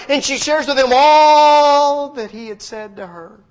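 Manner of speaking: loud, high, fervent sermon voice; full-force preaching. Acted. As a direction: preach it out, lifting the room.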